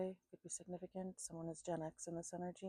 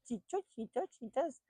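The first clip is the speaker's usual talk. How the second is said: laughing; 6 semitones higher, 7 dB louder than usual. Real, not acted. Laughing about dog's bay.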